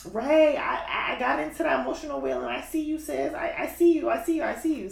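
imagined listener. Pretend. Host voices a follower messaging about the emotional wheel, lightly acted approval.

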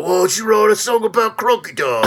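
put-on gruff Cockney geezer accent; rough London Cockney, Ray Winstone style. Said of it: "He's like Ray Winstone all of a sudden".

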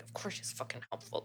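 a whispered, bitter aside to himself. Retold or imitated. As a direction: hiss it under your breath, stung. Scott bitter under his breath.

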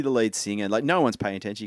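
ordinary delivery

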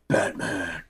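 low, hushed superhero voice; Batman. Acted. Fan echoing the movie hero's line, low mock-heroic voice. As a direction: drop it low and soft, imitating the hero.

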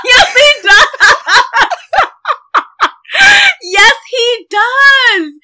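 high, loud laughing yes; shrieking laughter. Real, not acted. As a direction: squeal it out through laughter.

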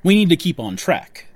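ordinary talk, nothing marked

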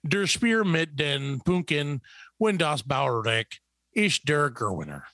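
slow, drawn-out mock German ad read; confidently mangled German with a heavy American tongue. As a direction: read out pretend German product copy with confidence. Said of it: "because I speak flawless German".

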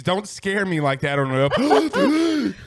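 slow, laughing through the words; laughing after a scare. Real, not acted. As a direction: still shaken, laughing it off. Startled by friend's scream.

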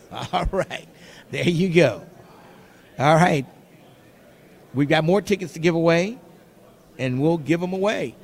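usual speaking voice

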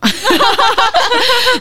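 loud held laugh; long high-pitched laugh. Real, not acted. Laughs at own age.

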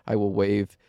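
ordinary talk

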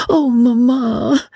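high, drawn-out tearful plea; pleading. A narrator's reading. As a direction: plead with mother, nearly crying. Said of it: "pleaded Suzanne".

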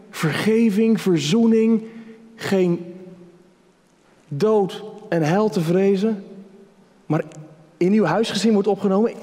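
a lightly voiced sinner, hesitant and pleading. Preacher voicing a sinner praying to Jesus, hesitant and pleading. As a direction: ask Jesus in disbelief, halting and hopeful. Acting a doubting sinner's prayer.

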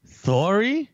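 high, loud query with "Sorry" lisped as "thorry"; mimicked lisp. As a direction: echo "Sorry" in disbelief, th for the s. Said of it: "they speak with a lisp".

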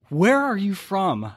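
high, slow, suspicious drawl of a Southern customer; a customer in the South quizzing a Boston salesman, high and suspicious. Retold or imitated. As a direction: ask it like a wary local. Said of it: "And he's like".

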